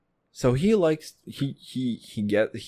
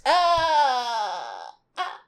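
mock wail; 10 semitones higher than usual.

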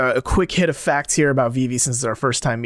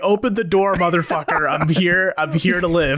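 higher, brash mock demand; hammed-up bold newcomer; higher cocky mock demand; imitating Vivi; 5 semitones higher than usual. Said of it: "Yeah, like".

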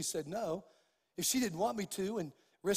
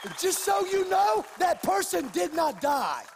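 loud, higher preacher's declamation; impassioned preaching; 5 semitones higher, 6 dB louder than usual. Real, not acted. Preacher retelling his rebuke of a doctor, impassioned preaching. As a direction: thunder it out, building to a triumphant declaration.

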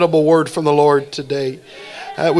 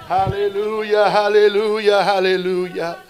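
fervent worship; 4 semitones higher than usual. Real, not acted. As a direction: lead the congregation in sustained, intoned hallelujahs. Leads congregation in praise and prayer.

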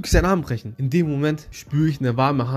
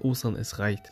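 angel voice; 4 semitones lower, 7 dB quieter than usual. Retold or imitated. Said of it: "Diese warme Hand mit dieser Engelstimme".

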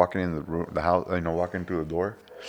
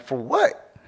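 put-on higher, scolding the young guy; playful exasperation, pitched up; mock scolding; 9 semitones higher, slower than usual. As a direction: tease him for complaining. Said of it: "I'm like".

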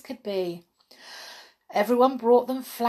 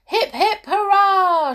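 very high, loud laughing hurrah; shouted cheer; 12 semitones higher, 9 dB louder, slower than usual. A narrator's reading. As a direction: cheer it out with joy. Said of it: "shouted everyone".

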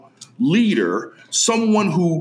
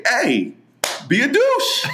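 laughing mock advice; 6 semitones higher, slower than usual. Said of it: "I just say".

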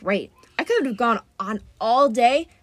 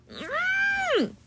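very high lowing whimper; distressed cow. Acted. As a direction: whine high like a frightened calf. Cow serpent lows in distress.

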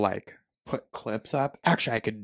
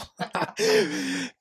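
high laugh. Real, not acted. Laughing hard.

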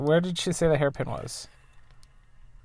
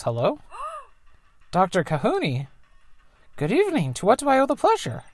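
higher, polite phone voice of the mom; 12 semitones higher, 3 dB louder than usual. Acted. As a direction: greet the doctor brightly as mom. Mom answers her phone.

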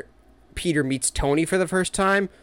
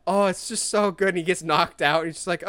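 normal talking voice

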